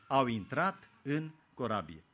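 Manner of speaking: ordinary talk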